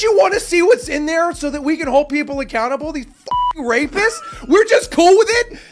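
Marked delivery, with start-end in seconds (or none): 4.5-5.6 higher, louder disbelief; appalled question; incredulous. Real, not acted. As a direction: throw it back in disbelief. Incredulous outrage.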